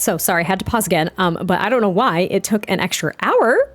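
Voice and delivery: usual speaking voice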